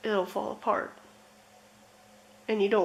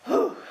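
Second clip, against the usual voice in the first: loud high whew of release; high exhale; 13 semitones higher, 5 dB louder than usual. Real, not acted. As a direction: blow out the emotion in one breath. Relief after emotional cards.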